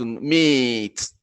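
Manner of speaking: "meat" with a drawn-out ee; long vowel. Shown as a demonstration. Teacher giving learners an example of long vowels, vowel drawn out. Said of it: "ليس طويل".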